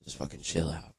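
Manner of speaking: low hushed whisper. Retold or imitated. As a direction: keep it secret from the cops, hushed. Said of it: "I was whispering".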